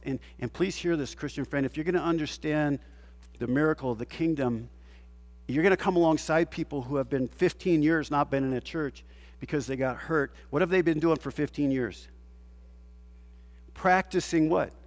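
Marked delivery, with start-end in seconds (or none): none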